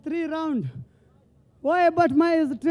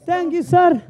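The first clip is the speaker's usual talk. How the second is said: loud thanks; 7 dB louder, slower than usual. Real, not acted. Winner thanking loudly.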